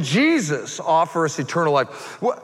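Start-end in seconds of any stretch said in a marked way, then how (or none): 2.2-2.4 high, insistent pulpit delivery; full-force preaching. Real, not acted. Preacher voicing doubts then proclaiming Easter, full-force preaching.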